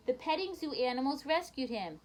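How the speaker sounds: plain reading voice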